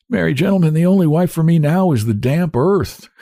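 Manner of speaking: put-on old cabman laughing at his loss; bitter laughing. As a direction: laugh it off sadly. Iona laughing sadly.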